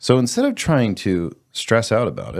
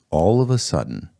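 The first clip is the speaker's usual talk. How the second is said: ordinary talk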